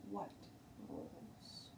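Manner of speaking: unvoiced whispered rules; whispered question. A narrator's reading. Teacher whispering into the girl's ear. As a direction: breathe it right in her ear.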